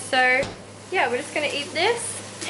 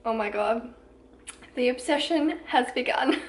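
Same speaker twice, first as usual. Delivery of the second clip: usual speaking voice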